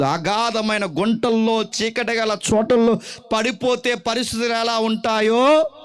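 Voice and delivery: lightly voiced lament of a man worn out by crying; weeping psalmist. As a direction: speak as the psalmist, pleading and heavy. (విలపించే కీర్తనాకారుడిలా)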